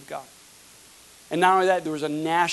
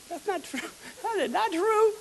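laughing denial. Real, not acted. Laughing rebuttal in high voice.

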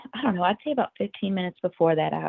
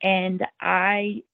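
ordinary delivery

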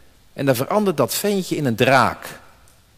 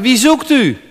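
soldiers asking; 6 semitones higher, 5 dB louder than usual. Acted. Soldiers ask in Gethsemane.

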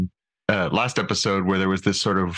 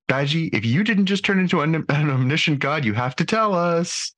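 higher, pleading demand; mock pleading; 8 semitones higher than usual. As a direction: playfully beg for a secret. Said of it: "from Mariner".

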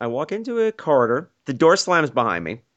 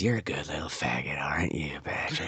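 very soft, low whisper; husky voice; 13 semitones lower, 13 dB quieter than usual. Retold or imitated. Stranger on top of him savours the name, husky whisper. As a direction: breathe it creepily into the ear. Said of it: "there's this husky voice in my ear".

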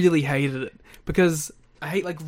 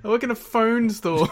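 mock clerk; 7 semitones higher than usual. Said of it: "he's like".

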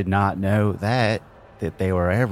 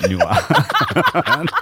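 laughing; 8 semitones higher, 5 dB louder than usual. Real, not acted. Blurted name while laughing.

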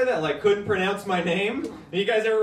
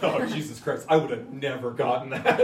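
low-pitched mock disbelief, well under the usual voice; imitated doctor; 9 semitones lower than usual. Doctor amazed at the patient's surname, low imitated disbelief. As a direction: play the floored doctor, dropped deep.

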